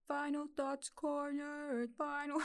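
slow, high mutant turtle jingle; rasping strained mutant. Shown as a demonstration. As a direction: sing it like a Ninja Turtles theme. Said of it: "if it sounds like Teenage Mutant Ninja Turtles".

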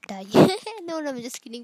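usual speaking voice

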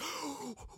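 big, high mock gasp; amazed gasp. Host reliving first sight of Marvel area, amazed gasp. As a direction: suck in air in exaggerated shock.

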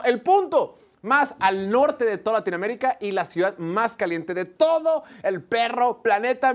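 loud, high-energy host welcome; booming show opener. Acted. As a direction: open the show big and bright for the crowd.

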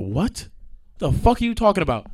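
usual speaking voice

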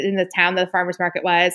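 ordinary talk

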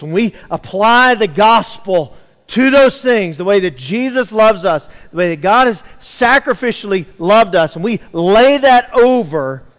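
impassioned pulpit build; rising preaching. Acted. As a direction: build the point toward a peak.

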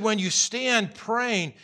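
"When you stand praying" drawn out slowly; slow, preached emphasis on "stand". Shown as a demonstration. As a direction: stretch every word to make the point. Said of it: "He said".

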